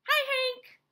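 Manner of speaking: high, loud friendly hail; calling out. A narrator's reading. Rosie the tumbleweed hails Hank, calling out high. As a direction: call a greeting across to someone. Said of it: "Rosie the tumbleweed called out".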